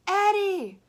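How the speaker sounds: high scolding scream. A narrator's reading. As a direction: scream his name to stop him. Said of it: "screamed one of the girls".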